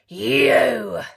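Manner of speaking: loud pounce of a word; wolf springing the trap; loud triumphant wolf cry; cried. A narrator's reading. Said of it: "cried the wolf".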